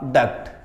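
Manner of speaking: डक्ट said clearly as "dukt" with a hard final t; pronounce duct. Shown as a demonstration. Teacher modelling डक्ट for students, clear "dukt". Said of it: "इस तरीके से होगा".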